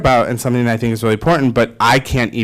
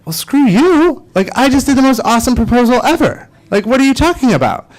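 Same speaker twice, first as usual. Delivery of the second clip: indignant mock retort; 11 semitones higher than usual. Telling the audience how he snapped back at his fiance, high indignant huff. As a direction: play up the offended pride, pitched way up.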